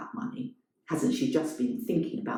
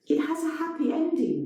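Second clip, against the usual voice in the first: high, pointed; higher, pointed; Elena's voice; 12 semitones higher than usual. A narrator's reading.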